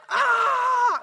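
loud, high drawn-out battle yell; full-voiced "Ah!" of victory; victory shout. Leader charging across the oval for the kids' freedom, victory shout. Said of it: "give a shout of victory".

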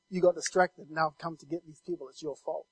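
low, very soft taunt; mocking devil. Devil blaming the distracted dreamer, quietly mocking. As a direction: voice the devil's understated mockery, dropping low and quiet. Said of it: "he's mocking me".

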